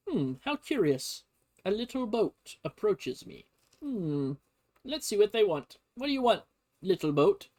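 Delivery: a pompous captain peering at a small boat; Biddle, puzzled. Retold or imitated. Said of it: "Biddle's like".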